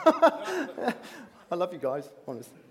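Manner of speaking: laughing through the words. Real, not acted. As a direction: chuckle it out fondly. Laughing to neighbours in audience.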